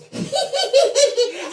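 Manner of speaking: high, loud mock cackle; imitated gleeful giggle. Uncle running out the door, high gleeful cackle.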